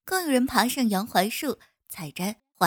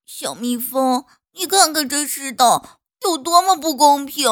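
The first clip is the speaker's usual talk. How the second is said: aggrieved flower; 3 semitones higher, 8 dB louder than usual. Acted. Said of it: "倾诉起了自己的委屈".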